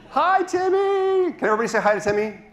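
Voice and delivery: put-on very high, gushing hello to children; overbright kids party host. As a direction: greet the room like a kids' party host. Greeting audience with puppet.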